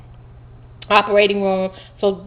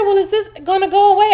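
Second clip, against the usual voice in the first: alarmed; 12 semitones higher, 6 dB louder than usual. Retold or imitated. Patient alarmed at lump.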